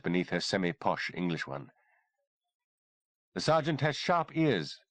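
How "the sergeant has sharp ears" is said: higher and slow, a teasing smile; amused little dig at the sergeant; slightly mocking smile. Kimberly teases the sergeant, slightly mocking with a smile. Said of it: "with a slightly mocking smile".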